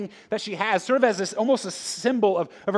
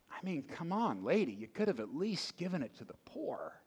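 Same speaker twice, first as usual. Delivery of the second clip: whispered complaint; 10 dB quieter than usual. Pretend. Disciples muttering at the woman.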